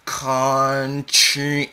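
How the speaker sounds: high, drawn-out syllables; slowly unfurled. A narrator's reading. Child taunts mother with her real name, slowly drawn out. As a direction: stretch the name out teasingly. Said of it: "you slowly unfurl her government name".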